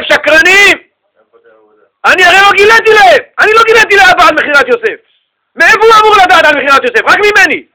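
high, loud outcry at liars; outraged accusation. Acted. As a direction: hurl it at them, loud and high-pitched. יוסף מטיח באחיו שהם משקרים. (זעקת האשמה רמה וזועמת)

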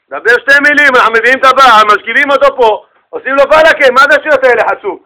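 loud, high, outraged offer to punish him; acted heated indignation; indignant students. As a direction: protest fiercely on your rabbi's behalf. Indignant students threaten husband.

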